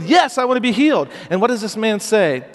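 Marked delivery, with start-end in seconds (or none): 0.0-1.1 much higher and louder, bursting yes; excited loud yes. Shown as a demonstration. To the congregation, modelling the paralytic's eager answer, loud and excited. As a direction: shout it like you can't wait to be healed. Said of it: "do you say it louder than that".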